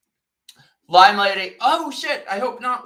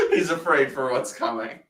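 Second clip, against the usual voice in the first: ordinary talk, nothing marked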